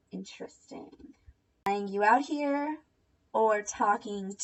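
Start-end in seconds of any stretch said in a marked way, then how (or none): 0.1-1.1 breathy whispered interesting; dry whispered interesting; sarcastic whisper. Real, not acted. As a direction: murmur it with suspicion. Sarcastic reaction.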